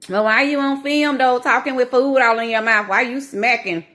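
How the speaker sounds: imitating a snippy viewer picking at her eating; mocking commenter. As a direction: needle the vlogger like a rude commenter. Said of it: "that type of comment".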